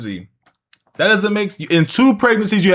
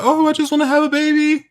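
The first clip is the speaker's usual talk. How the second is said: very high, sing-out put-on voice; mock innocent mother; 13 semitones higher than usual. Commentator voicing the mother wanting a baby, mock innocent. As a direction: imitate a naive would-be mom, pitched way up.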